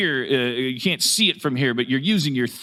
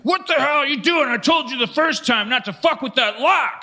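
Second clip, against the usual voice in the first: angry jailer; 13 semitones higher than usual. Acted. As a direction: yell like an outraged warden. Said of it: "and he goes like".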